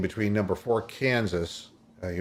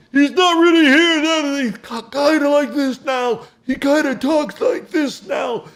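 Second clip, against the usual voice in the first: put-on much higher, louder, cracking old-announcer voice; Dickie V impression; 7 dB louder than usual. Radio host imitates Dickie V calling the game, high and cracking. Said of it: "I'll try to do his voice now".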